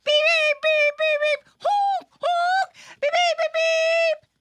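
high, loud honking and beeping; playful car horn noises. Pretend. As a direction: toot like busy city traffic. Imitating traffic again.

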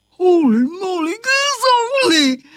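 high, quick cry of shock; astonished. A narrator's reading. Carpenter seeing his dog's leg, astonished yelp. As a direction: exclaim in startled disbelief.

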